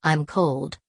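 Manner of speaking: ordinary delivery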